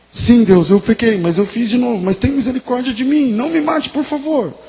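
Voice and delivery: loud outburst rising to a high plea; desperate pleading. Acted. Sinful man begs God not to kill him, desperately pleading. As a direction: confess and beg, pitch climbing phrase by phrase.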